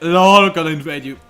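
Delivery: loud mocking taunt. Gamer taunting Budapest from its borders, loud mock jeer. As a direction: jeer at the enemy city. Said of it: "and be like".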